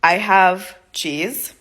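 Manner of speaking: very slow, broken beginner phrases; slow simple words. Retold or imitated. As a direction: spell it out word by word like a learner. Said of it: "I said, in Portuguese".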